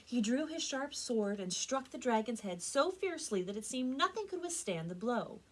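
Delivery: usual speaking voice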